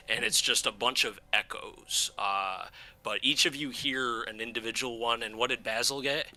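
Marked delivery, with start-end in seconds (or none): none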